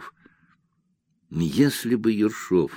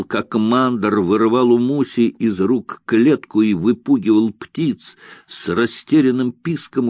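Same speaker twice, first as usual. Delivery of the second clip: ordinary delivery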